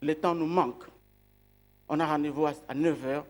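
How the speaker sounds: ordinary talk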